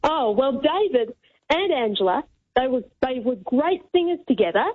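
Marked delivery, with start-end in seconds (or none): none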